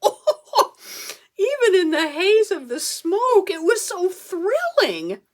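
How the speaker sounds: high, loud, breathless rapture with a laugh; thrilled gush. Acted. New royal heir to the audience, giddy and laughing. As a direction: swoon over being crowned. Crowned in the smoky dungeon.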